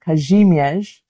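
the name Kazimierz said the Polish way, lightly; an English speaker's careful attempt at Polish Kazimierz; Polish pronunciation. Shown as a demonstration. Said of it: "how terrible my Polish accent was".

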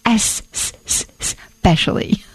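ESPECIALLY opened on a long, repeated hissing S; drawn-out S. Shown as a demonstration. Host correcting a pet peeve, exaggerated S sound in "S s-, s-, specially". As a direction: stretch "es-s-s" before "specially", slowly. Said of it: "It is not X specially".

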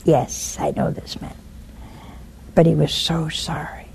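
soft, low, unvoiced reply; tender hushed answer; whispered voice of God. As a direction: whisper it like gentle mercy.

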